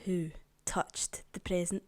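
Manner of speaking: low, slow telling-off, mildly acted; mock stern. Mum asking the children who touched the present, mock stern. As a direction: imitate an angry mum with measured restraint. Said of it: "Not as angry as that".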